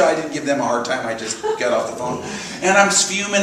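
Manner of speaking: ordinary delivery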